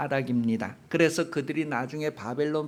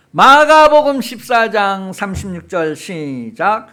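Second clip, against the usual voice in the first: loud, higher-pitched call to read together; calling out the reading cue; 5 semitones higher, 15 dB louder than usual. Real, not acted. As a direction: lead the congregation in with a big, drawn-out call. Cues congregation to read verse together.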